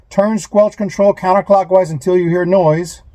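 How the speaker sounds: ordinary talk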